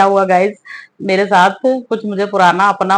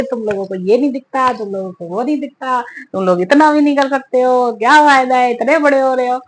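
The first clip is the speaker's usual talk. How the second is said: mom scolding; 5 semitones higher than usual. Retold or imitated. Mother scolding daughter who cleaned the house, angry imitated rant. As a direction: rattle off the complaints like a scolding mom. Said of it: "मेरी mummy ने आते ही नाम सुनाने शुरू कर दिए".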